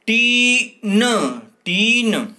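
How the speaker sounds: टीन said high and loud, twice; called-out word. Shown as a demonstration. Teacher to pupils, calling out टीन loudly. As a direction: call out टीन to the class. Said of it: "तो क्या शब्द बनेगा?".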